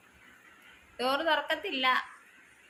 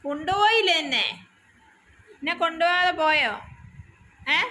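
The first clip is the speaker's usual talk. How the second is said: voicing the pet; 5 semitones higher, 7 dB louder than usual. Voicing the crying pet's complaint.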